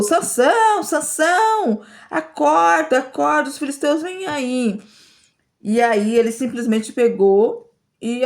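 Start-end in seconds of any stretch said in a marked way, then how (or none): 0.0-4.8 high, drawn-out mock call to wake Samson; retold shout. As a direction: call him urgently, pitched well up. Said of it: "E aí ela gritou". (Dalila chamando Sansão em tom alto e urgente)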